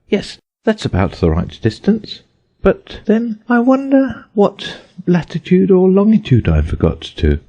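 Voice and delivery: put-on a little girl showing off words; young Alice. A narrator's reading. Alice talking to herself.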